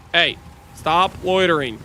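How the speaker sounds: ordinary delivery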